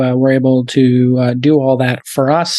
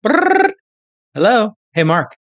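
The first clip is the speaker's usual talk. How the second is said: mock prank call; 7 semitones higher, slower than usual. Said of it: "Have me like".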